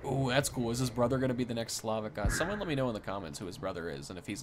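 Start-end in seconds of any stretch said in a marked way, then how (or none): none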